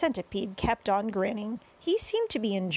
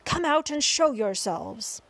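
raised, slow commanding call; shouted order; 5 semitones higher, 4 dB louder than usual. A narrator's reading. Chief of police orders the hidden travelers out, shouted order. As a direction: order them out, drawing the words long. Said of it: "shouted the chief of police".

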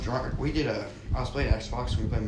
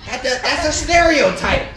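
indignant protest; 14 semitones higher, 10 dB louder than usual. Real, not acted. Friend protesting teasing.

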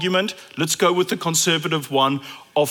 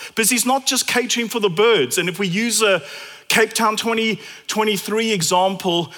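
ordinary delivery

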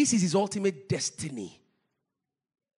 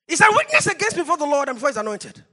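loud, high, rapid declamation; forceful challenge; 8 semitones higher, 11 dB louder, faster than usual. Acted. Preacher repeating Samuel's challenge, loud, high and rushed. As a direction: fire the challenge out fast and loud.

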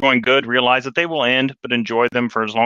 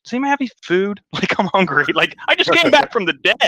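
much higher, light and casual, hungry; playful high Jesus; 9 semitones higher than usual. Retold or imitated. Retelling Jesus after the resurrection, high and playful. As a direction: toss it off high and breezy like a hungry guy. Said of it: "And then Jesus goes".

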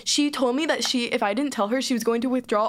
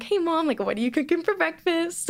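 fake casual innocence; 6 semitones higher than usual. Acting casual hiding a secret.

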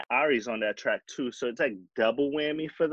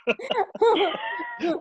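squealing laugh; high laugh. Real, not acted. Laughing at joke.

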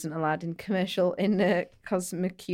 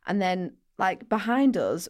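usual speaking voice

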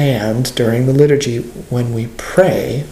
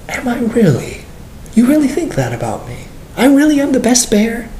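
high, hopeful questioning; disbelieving wonder; 8 semitones higher than usual. Acted. Believer asking the Lord about being the best bear, disbelieving wonder. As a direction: ask it as if hardly daring to believe it. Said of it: "basically you telling the Lord".

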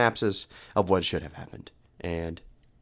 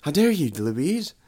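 higher, mock-upset Australian-like dude; Todd; 4 semitones higher, much slower than usual. Todd pleads as Louise leaves, mock-offended, Australian-sounding. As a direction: protest in a put-on Australian accent. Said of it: "I'm gonna use my Todd voice".

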